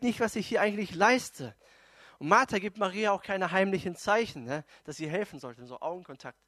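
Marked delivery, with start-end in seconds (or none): none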